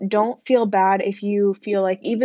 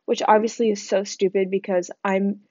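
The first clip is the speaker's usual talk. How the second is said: ordinary talk, nothing marked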